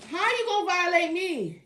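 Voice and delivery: loud, high, indignant question. Real, not acted. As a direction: protest the harassment. Complaining about harassment, exaggerated.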